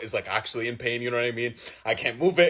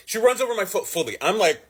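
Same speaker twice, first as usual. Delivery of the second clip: ordinary talk, nothing marked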